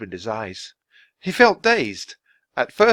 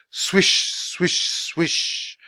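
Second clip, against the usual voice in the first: breathy swish of sliding runners; whooshing sleigh sound; 5 semitones higher, much slower than usual. A narrator's reading. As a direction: whoosh it like wind over snow.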